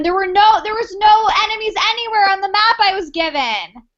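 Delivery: angry. Acted. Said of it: "seeing red ... how angry I am".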